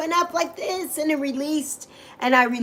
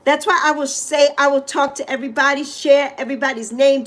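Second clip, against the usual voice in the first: normal talking voice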